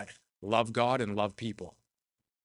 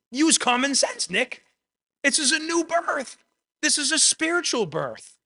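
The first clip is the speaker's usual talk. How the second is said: much higher, exasperated teacher voice, pressing the obvious; a pitched-up, incredulous rebuke; exasperated Jesus; 10 semitones higher than usual. Retold or imitated. Jesus chiding Nicodemus, higher and exasperated. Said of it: "Jesus says".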